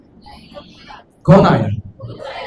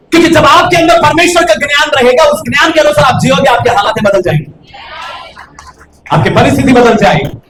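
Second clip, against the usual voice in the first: louder, higher promise to the crowd; fiery preaching; 7 semitones higher, 3 dB louder than usual. Acted. Pastor to his congregation, fiery preaching. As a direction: build it up with fervour.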